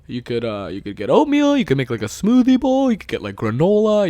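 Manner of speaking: musing, swooping high-pitched ums and suggestions; imitating patient friend. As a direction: play the nice guy pondering ideas. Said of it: "being a nice guy and having patience".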